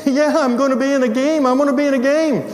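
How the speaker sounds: very high, elated student; all excited. Retold or imitated. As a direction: imitate a kid crowing with joy. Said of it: "all excited".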